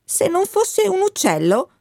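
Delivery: put-on a boy lying on the grass asking; boy's question. Benvenuto chiede, disteso a terra. (il ragazzo chiede, voce molto più acuta)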